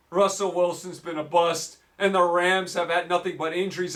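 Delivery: ordinary talk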